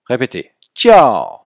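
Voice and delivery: jiao high and sharply falling, slow. Shown as a demonstration. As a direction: model jiao with a strong fourth tone. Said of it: "se prononce jiao". (jiao au quatrième ton, appuyé et descendant)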